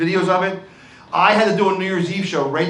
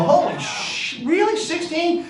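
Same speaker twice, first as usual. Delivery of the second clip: mock shock; 10 semitones higher, slower than usual. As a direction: pitch jumps much higher in disbelief. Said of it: "I go".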